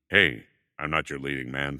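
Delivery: lightly voiced leading-man type; mock leading man. Character actor mocking leading men, lightly put-on low voice.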